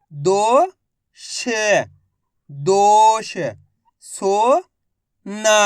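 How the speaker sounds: each word drawn out very slowly, then said once at normal pace; phonics drill. Shown as a demonstration. Teacher models reading ओ-matra words for children, slow then normal.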